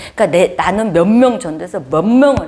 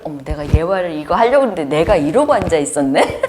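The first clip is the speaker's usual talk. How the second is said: laughing. Real, not acted. Realizing her own mistake.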